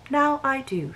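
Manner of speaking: a wife quietly conceding the point; murmured. Acted. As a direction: let the line fall almost to a murmur. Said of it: "she murmured".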